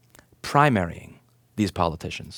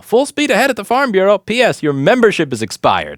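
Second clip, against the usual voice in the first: mocking email read; 11 semitones higher, 8 dB louder than usual.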